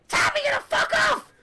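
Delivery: very high, loud gnome voice; shrill little gnome, eager to please; tiny gnome shouting orders, high and loud; squeaky gnome. Acted. Gnome challenging the guards.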